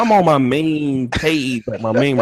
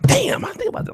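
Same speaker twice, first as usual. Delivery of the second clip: high-pitched, awestruck outburst; mock amazement. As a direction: blurt it out as if your mind is blown. Acting awed reaction to guest's wisdom.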